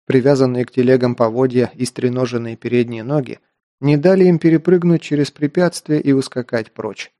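usual speaking voice

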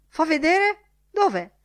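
high, loud, excited questions; eager exclamation. A narrator's reading. Lenihan running to the window to look, exclaiming high and loud. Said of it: "esclamò Lenihan".

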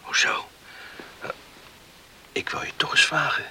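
hushed night whisper; whispering in bed. Acted. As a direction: whisper so nobody wakes.

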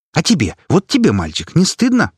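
high, loud indignant scolding; shaming scold. Acted. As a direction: shame the boy. Activist shames boy.

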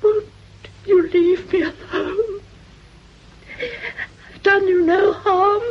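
crying, voice breaking as she begs; tearful pleading. Acted. Frightened lodger begging Mrs Payne through tears. Miss Beringer pleads, persecuted.

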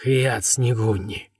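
very slow, exhaled strange words; breathed out. A narrator's reading. Delirious Злата utters strange words to Тима, slowly breathed out. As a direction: draw the words out on a long breath. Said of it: "выдохнула".

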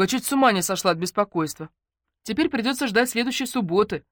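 mildly agitated phone talker; chatty patient. Acted. Voices Natashka on the phone.

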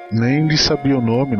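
normal talking voice

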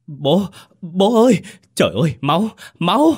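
high, loud cries of horror; panicked scream. A narrator's reading. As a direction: scream out at the sight of blood. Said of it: "Bỗng anh Quý hét lên". (hét lên thất thanh)

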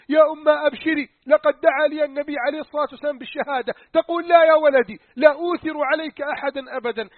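loud, urgent pleading back and forth. Acted. Haritha and his mother plead loudly over martyrdom. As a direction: cry out the plea.

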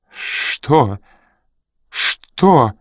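high, frightened faltering question; voice failing. A narrator's reading. Старик under Ян's fierce gaze, voice failing. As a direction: stammer it out, losing your voice. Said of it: "Голос изменил ему".

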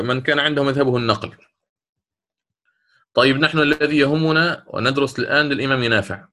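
normal talking voice

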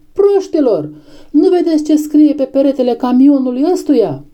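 high, exasperated outcry; exasperated shout. A narrator's reading. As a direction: berate the crowd in a high voice. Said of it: "țipă Benjamin". (strigăt exasperat, pe ton înalt)

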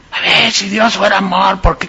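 ordinary delivery

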